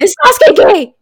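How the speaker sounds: high frantic yell at strangers; aggressive shouting. Pretend. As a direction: shout it in their face, breathless. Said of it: "run aggressively up to people".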